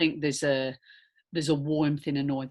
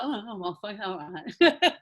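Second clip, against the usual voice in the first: mock resigned; 3 semitones higher, slower than usual. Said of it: "You're like, you're like".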